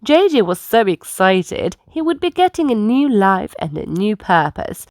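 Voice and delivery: usual speaking voice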